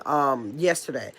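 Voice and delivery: ordinary delivery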